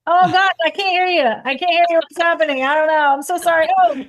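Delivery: high, loud fake phone breakup; fake bad connection. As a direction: pretend the line is cutting out. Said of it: "I'll be back here with paper like".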